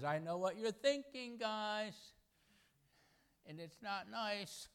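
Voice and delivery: a wry all-knowing voice that slows at the end; knowing Jesus. Acted. Preacher voicing Jesus reading the scribes' minds, high and teasing. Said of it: "The omniscient Son of the living God says".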